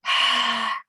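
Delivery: long, audible sighing exhale; deep breath out. Shown as a demonstration. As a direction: breathe out fully to lead the group. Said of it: "let's take a breath".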